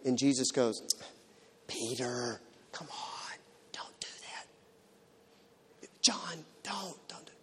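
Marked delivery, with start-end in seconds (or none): 1.7-7.3 hushed, half-whispered pleading; meek mild rebuke. Shown as a demonstration. Jesus softly chiding disciples who push children away, meek whisper. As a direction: gently whisper a feeble telling-off. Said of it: "Is that indignant?".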